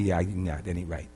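low, soft brush-off; understated skeptical reply; quiet dismissal. Retold or imitated. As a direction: murmur a doubtful no, trailing down. Retelling his doubtful reply.

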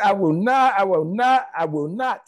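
loud, pounding I will not; God's promise shouted out; emphatic repetition. Retold or imitated. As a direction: hammer each I will not home. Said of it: "you said".

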